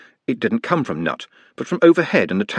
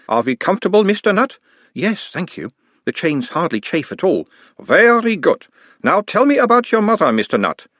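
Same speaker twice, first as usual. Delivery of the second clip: put-on mild Uberwald accent; 9 semitones higher than usual. A narrator's reading. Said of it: "his tone of voice changing slightly".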